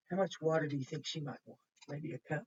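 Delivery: ordinary delivery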